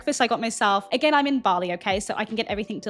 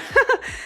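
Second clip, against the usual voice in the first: a quick high laugh bursts out; burst of laughter; 14 semitones higher, 5 dB louder than usual. Real, not acted. Telling viewers about a friend, a sudden high laugh. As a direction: laugh at how silly it was. Laughs at guys being unkind.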